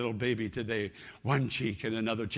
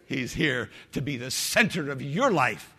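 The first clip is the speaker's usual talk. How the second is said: ordinary delivery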